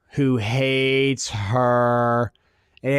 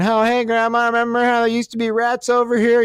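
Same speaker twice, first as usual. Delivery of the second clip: granddaughter's cheery voice; 8 semitones higher than usual. Retold or imitated. Granddaughter at the big reveal.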